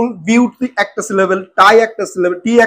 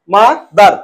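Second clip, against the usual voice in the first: "Mother" in two separate beats; split into syllables; 3 dB louder, much slower than usual. Shown as a demonstration. Teacher demonstrating two syllables, "mother" said in two parts. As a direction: break "mother" after the first syllable, pausing briefly. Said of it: "দুটো সিলেবেলে উচ্চারণ করছি".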